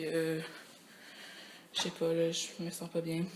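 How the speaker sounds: normal talking voice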